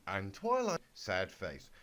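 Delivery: usual speaking voice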